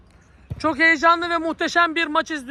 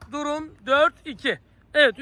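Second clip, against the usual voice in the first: ordinary talk, nothing marked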